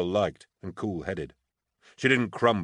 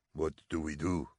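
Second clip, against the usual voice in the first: asking quietly; 2 semitones lower, 7 dB quieter than usual. A narrator's reading. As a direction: ask it softly, for one listener only. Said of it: "the sergeant major asked quietly".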